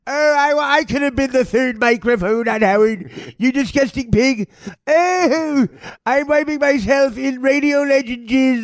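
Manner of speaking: high-pitched, hammed-up squeal of disgust; disgusted woman. Heidi recoiling from the stained sheets, shrieking in disgust.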